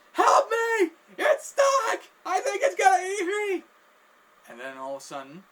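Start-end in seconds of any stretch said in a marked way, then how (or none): 0.1-3.6 very high, loud, tearful screams; panicked wailing. Retold or imitated. Victim begging to be freed from the ear, panicked wailing. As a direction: wail in hammed-up terror. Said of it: "he's just screaming, wailing in pain".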